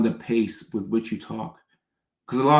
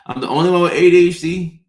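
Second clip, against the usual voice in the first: mock lament; 7 semitones higher, 8 dB louder than usual. Retelling feeling alone, laughing.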